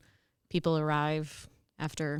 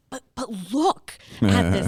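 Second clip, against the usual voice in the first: slow, louder insistent mom; mom pointing out; 3 semitones higher, 8 dB louder than usual. Retold or imitated. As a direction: make them look at the screen. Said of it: "she would be like".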